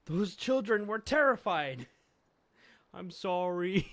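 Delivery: loud, high-pitched telling-off; angry scolding. Acted. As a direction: scold them for scaring the kids. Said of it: "disciplines Usopp and Luffy".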